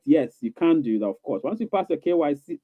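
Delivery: usual speaking voice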